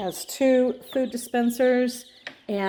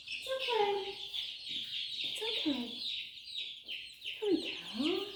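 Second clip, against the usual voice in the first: high, soft soothing coo; 9 semitones higher, 12 dB quieter than usual. Real, not acted. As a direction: comfort a scared chick softly. Soothing chicks in high baby-talk.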